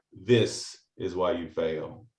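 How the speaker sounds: low, slow, soft wise master; Yoda voice. Retold or imitated. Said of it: "And Yoda responds by".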